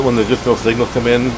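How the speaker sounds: ordinary talk, nothing marked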